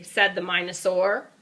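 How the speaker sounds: usual speaking voice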